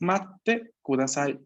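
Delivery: "Matte kudasai" said slowly with a held double t, matte with a clear pause before te; slow model word. Shown as a demonstration. Teacher models "Matte kudasai" slowly for students. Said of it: "que es".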